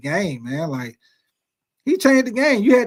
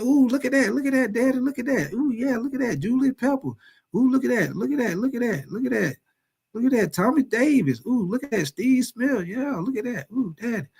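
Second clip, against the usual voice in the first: higher, lightly voiced excited kid; excited little kid; 5 semitones higher than usual. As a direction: point things out like a thrilled child at the stadium. Voicing excited little son.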